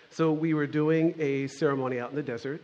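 normal talking voice

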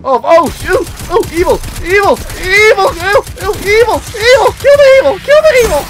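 very high, loud cries; panicked shrieking. Real, not acted. Player suddenly attacked by evil creatures, panicked shrieks. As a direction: shriek in a frantic burst.

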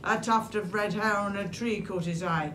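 ordinary delivery

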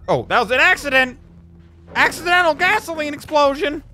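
high-pitched excited yell; alarmed, high-pitched announcement; startled exclamation. Real, not acted. Surprised by accidental explosion in game.

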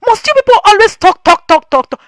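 loud, high, fed-up rebuke; exasperated scolding. Real, not acted. Vlogger scolding fans who gossip, loud and fed up. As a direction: scold them for endless talking.

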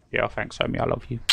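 ordinary delivery

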